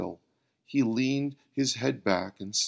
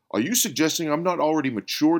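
higher, louder, fast indignant challenge; demanding; 6 semitones higher, 6 dB louder, much faster than usual. Acted. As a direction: fire back, offended. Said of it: "demanded Bill".

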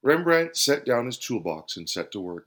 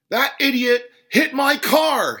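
high, loud, drawn-out outraged shout; angry cry; 14 semitones higher, 8 dB louder, slower than usual. A narrator's reading. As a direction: yell it slowly in outrage. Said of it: "he cried".

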